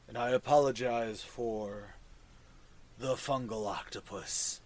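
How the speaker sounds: low, soft fungal creature voice; mushroom voice. Acted. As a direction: speak low and hushed like a mushroom.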